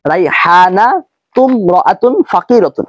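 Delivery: slow, রাইহানাতুম linked straight into রাআতুন with no alif sound; correct pronunciation. Shown as a demonstration. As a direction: model the right way, slow, রাইহানাতুম রাআতুন joined. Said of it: "এভাবে উচ্চারণ করতে হবে". (সঠিক উচ্চারণ: ধীরে, রাইহানাতুম রাআতুন জুড়ে)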